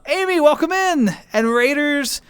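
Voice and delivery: high, bright-pitched greeting; lifted, singsong hello; excited welcome. Real, not acted. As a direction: call out a welcome to arriving guests. Greets arriving raiders.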